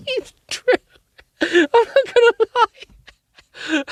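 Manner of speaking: squeaky voice through giggles; laughing. Real, not acted. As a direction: say it while cracking up.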